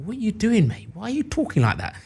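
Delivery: put-on high, soft incredulous English accent; English mate's accent. English friends questioning his American accent, high English-accented disbelief. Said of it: "his friends who were from England".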